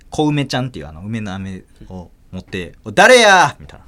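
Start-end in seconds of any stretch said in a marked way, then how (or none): 2.8-3.6 loud high stern demand; angry demand. Retold or imitated. Mother bursting out demanding who did it, loud angry shout.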